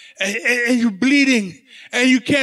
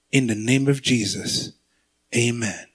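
quiet prayer; 12 semitones lower, 5 dB quieter than usual. Acted. Weakened believer calls on Jesus, very low and quiet. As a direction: pray it low, with little strength left. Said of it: "And I say".

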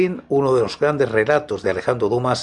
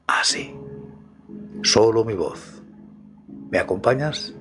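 right in your ear. Acted. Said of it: "y escucha mi voz". (susurro íntimo al oído)

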